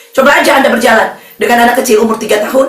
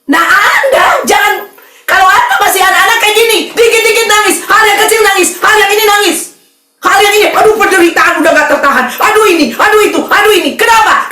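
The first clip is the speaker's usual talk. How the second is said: raised mocking sermon rebuke; fiery preaching; 6 semitones higher than usual. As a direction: scold the whiny believers from the pulpit. Preaching at full force. (menegur jemaat dengan khotbah berapi-api)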